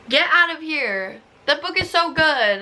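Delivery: high, incredulous get out of here; high disbelieving outburst at a review; indignant protest. Real, not acted. Outraged at a bad review.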